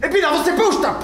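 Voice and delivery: high, blustering warning; panicky threat. Acted. Man warns lover not to come closer, high bluster. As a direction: warn her off at high pitch.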